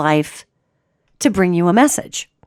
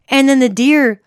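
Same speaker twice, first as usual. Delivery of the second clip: usual speaking voice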